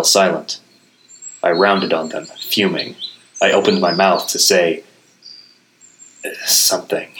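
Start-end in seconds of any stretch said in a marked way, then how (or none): none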